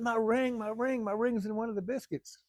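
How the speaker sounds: high, wailing imitation of her scream; retold scream. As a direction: act out her outcry high, not at full volume. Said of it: "She screamed".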